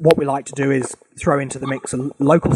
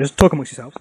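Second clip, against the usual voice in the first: ordinary talk, nothing marked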